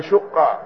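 "شقة" as shuqqa; short u after the shin in "شقة"; damma on the shin. Shown as a demonstration. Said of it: "وأفصحهما الضم".

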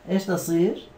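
ordinary talk, nothing marked

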